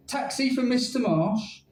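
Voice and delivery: lightly voiced robot greeting; flat mechanical robot voice. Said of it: "it said to me in a robot voice".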